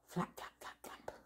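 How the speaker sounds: breathy wing flaps; whispered flapping. Shown as a demonstration. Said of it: "Eagle sound".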